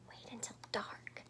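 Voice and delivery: whispered secret plan; whispered eager promise; excited whisper. Acted. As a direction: hush it eagerly. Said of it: "Stellaluna said excitedly".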